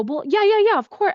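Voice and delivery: mock a student agreeing quickly; eager mock yeah yeah; imitating eager student. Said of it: "And they go".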